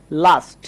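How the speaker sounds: লাস্ট as "laast", long open AH; AH vowel. Shown as a demonstration. Teacher models A before S-T, AH vowel. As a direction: say লাস্ট clearly with AH. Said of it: "আয়ের মতো উচ্চারণ হচ্ছে".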